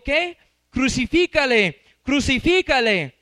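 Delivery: loud, slow crowd cry, "Crucifícale" hammered; the chief priests shouting at Pilate for crucifixion. Acted. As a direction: shout it like the mob.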